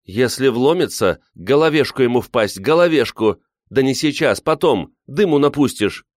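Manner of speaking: high-pitched, agitated instructions; urgent orders. A narrator's reading. Woman commanding them as a bear approaches, urgent. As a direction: fire off orders in a flurry of alarm.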